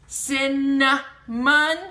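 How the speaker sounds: "Cinnamon" slow and loud in three beats, sin, nuh, mun; sounding out. Shown as a demonstration. Teacher showing the middle sound, "Cinnamon" slowly split. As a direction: punch each syllable of "Cinnamon" apart. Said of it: "in the middle".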